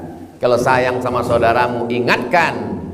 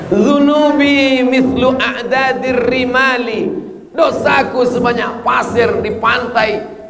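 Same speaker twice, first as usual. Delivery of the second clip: preaching at full force; slower than usual. Acted. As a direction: cry out the sinner's prayer.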